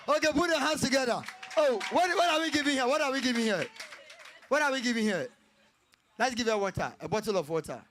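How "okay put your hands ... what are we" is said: excited cheer. Real, not acted. Cheering the winner.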